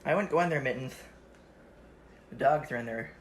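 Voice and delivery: ordinary delivery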